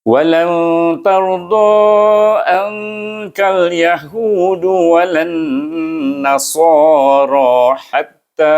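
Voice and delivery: chanted Quranic recitation. Shown as a demonstration. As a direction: intone the verse like a qari. Recites the verse in tajwid.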